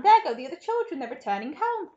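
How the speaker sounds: high, loud calling out; excited cry. A narrator's reading. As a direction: announce the sighting eagerly. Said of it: "he cried".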